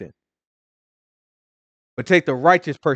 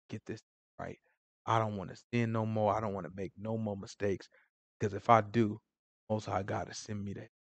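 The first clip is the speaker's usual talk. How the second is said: hushed, low, troubled pleading; stressed-out confession; 4 semitones lower, 13 dB quieter than usual. Pretend. Churchgoer confessing mistakes to Christians, stressed-out and hushed. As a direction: confess softly, as if weighed down by the slip. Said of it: "Just go in there real stressed out about it".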